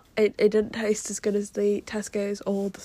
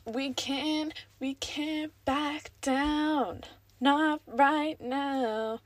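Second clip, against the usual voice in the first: higher, very slow, strained push; straining with effort; 6 semitones higher, much slower than usual. Real, not acted. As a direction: force the words out while prying the lid open. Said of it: "sounding like I'm going for a poo".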